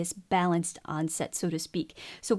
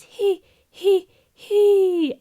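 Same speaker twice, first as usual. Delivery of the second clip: high, loud back-placed he; placed far back; 10 semitones higher, 10 dB louder, much slower than usual. Shown as a demonstration. Vocal coach showing back placement again. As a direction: let breath in and push he back. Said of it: "If I true far back".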